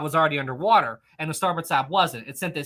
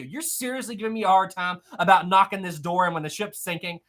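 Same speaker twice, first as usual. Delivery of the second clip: ordinary delivery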